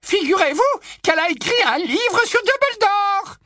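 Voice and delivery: put-on high, loud old busybody voice; high loud tipsy old aunt gushing; old aunt Muriel.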